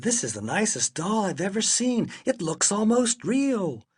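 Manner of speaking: lightly voiced delighted lady; admiring store lady. A narrator's reading. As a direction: marvel over a lovely doll.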